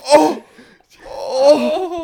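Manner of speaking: high, loud startled cry; shocked. Pretend. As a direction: gasp out in mock shock. Mock shock at ghost prank.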